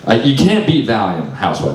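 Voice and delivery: ordinary talk, nothing marked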